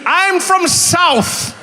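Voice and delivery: high, very slow mock drawl; slow, high hammed-up drawl. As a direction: draw it out like a yokel. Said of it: "what do you mean".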